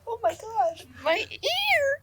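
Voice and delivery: high, shrill-free yelp of ear pain; retold pained yell. She recalls the cheese-grater mic hurting her ear, yelling. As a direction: cry out as the grater hits your ear. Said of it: "and I'm like".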